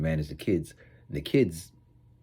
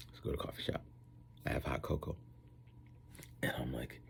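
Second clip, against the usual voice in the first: whisper; 5 semitones lower, 15 dB quieter than usual. Acted. Dropped to whisper.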